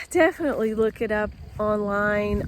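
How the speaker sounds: ordinary talk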